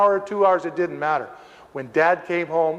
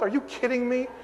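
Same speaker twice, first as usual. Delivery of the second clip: ordinary talk, nothing marked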